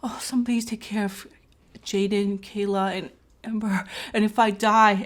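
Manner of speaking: soft, low, private plea; murmured mock deathbed prayer; silent prayer. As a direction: pray quietly, as if to yourself. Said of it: "I started having prayers in my head".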